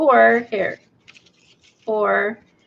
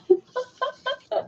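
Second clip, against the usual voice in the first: high, soft giggle; helpless giggle; 7 dB quieter than usual. Real, not acted. Crafter who can't help herself, helpless giggle. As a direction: giggle at your own silliness. Amused at her silly scene.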